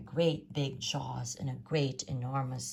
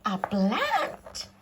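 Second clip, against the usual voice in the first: a high, louder cry of disbelief; horrified yell; 5 semitones higher, 6 dB louder than usual. A narrator's reading. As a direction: yell it in shock as the mother. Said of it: "yelled Mum in horror".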